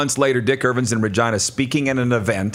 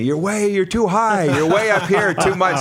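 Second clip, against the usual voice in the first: mock high voice; 8 semitones higher than usual. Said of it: "those guys would always say to me".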